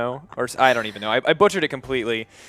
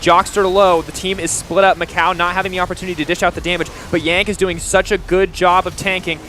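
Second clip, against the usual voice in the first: loud, high esports casting; play-by-play; 6 semitones higher, 5 dB louder than usual. Real, not acted. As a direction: call the fight as it happens. Live play-by-play of a fight.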